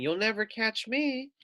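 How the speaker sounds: high, cheeky little lamb. Retold or imitated. Lamb taunting the wolf she tricked, high cheeky voice. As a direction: tease like a tiny lamb who got away. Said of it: "the little lamb saying".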